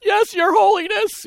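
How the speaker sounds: high-pitched meek reply; mock deference. Trembling assistant answering the great surgeon, high and meek. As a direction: squeak it out, mock-reverent. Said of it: "you know, something like that".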